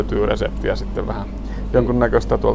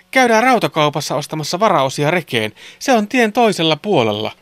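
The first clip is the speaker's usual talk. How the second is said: put-on boys speaking; 6 semitones higher than usual. A narrator's reading. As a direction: pipe up eagerly with a suggestion. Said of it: "pojat kertoivat". (poikien korkea, kova ääni)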